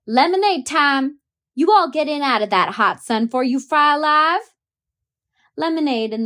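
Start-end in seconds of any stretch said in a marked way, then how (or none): 0.1-4.5 louder, higher call from the door. A narrator's reading. Housekeeper calling children in for lemonade, loud and high. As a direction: call the kids in from the sun.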